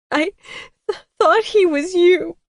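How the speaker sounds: high, loud tearful pleading; crying. A narrator's reading. Narrator voicing herself crying to Colton. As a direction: sob it out to him. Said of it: "I cried".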